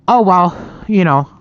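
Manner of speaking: ordinary delivery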